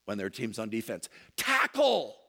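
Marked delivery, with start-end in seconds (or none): 1.4-2.2 high, loud stadium shout; fan yell. Retold or imitated. Pastor imitating football fans at a game, high yell. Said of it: "when the fans yell".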